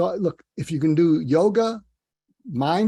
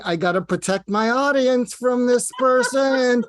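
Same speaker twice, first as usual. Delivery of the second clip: acted-out worried inner voice, higher and louder; imagined inner thought; 8 semitones higher, 4 dB louder than usual. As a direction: voice the thought like a host in mild alarm. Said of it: "and you're thinking".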